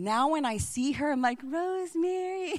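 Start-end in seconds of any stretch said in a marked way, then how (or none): 1.4-2.5 delighted greeting. Retold or imitated. Greeting the chatty Boston woman.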